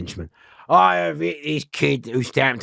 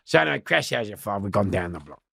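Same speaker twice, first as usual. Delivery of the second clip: usual speaking voice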